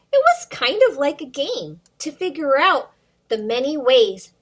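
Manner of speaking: put-on lively read-aloud for little kids; storybook reading. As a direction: read the rhyme to children, bouncing along. Reads picture book to kids.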